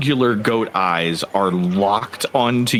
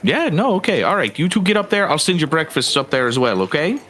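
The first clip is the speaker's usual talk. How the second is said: lightly voiced higher helper, agreeing readily; obliging helper character; 6 semitones higher, faster than usual. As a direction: send them off in a slightly raised, accommodating voice. Game master voices a helpful character.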